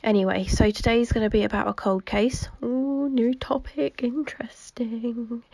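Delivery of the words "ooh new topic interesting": breathy mock-intrigued whisper, slow. Teasing her viewers with a slow mock-intrigued whisper. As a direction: hush it like a juicy secret, drawn out.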